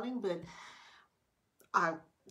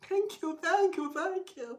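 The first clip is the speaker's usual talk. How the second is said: gushing thanks; 12 semitones higher, 6 dB louder than usual. Retold or imitated. Grateful and feeling privileged after the haircut.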